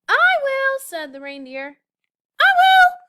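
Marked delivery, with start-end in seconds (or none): 0.1-0.8 very high, loud eager shout. A narrator's reading. Reindeer volunteering for presents, high eager shout. 2.4-3.0 very high, loud yell. A narrator's reading. Penguin yelling to open presents, very loud. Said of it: "yelled the penguin".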